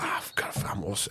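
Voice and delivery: hushed, rushed whisper to himself; panicked whisper. Retold or imitated. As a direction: mutter it under your breath in panic. Lost ref panicking mid-match.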